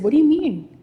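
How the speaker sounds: high, loud retold surprise; startled question. Mother questioning son about the sister he saw, startled. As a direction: ask it sharply taken aback.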